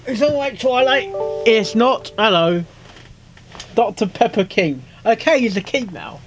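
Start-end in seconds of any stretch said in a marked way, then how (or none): none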